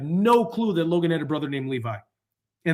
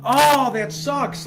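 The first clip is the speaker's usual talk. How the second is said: much higher, very slow, drawn-out groan of a line; milk the letdown; hammed-up letdown that the chat missed it; exaggerated disappointment; 10 semitones higher, 4 dB louder, much slower than usual. Real, not acted. Chat didn't hear the call.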